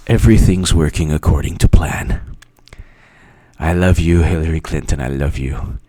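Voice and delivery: sinister breathy whisper; villain whisper. Pretend. Villain whispering his scheme to himself.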